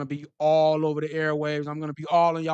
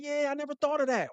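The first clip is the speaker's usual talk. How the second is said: mock realization; 9 semitones higher than usual.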